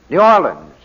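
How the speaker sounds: ordinary talk, nothing marked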